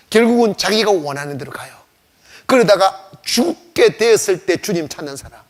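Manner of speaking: normal talking voice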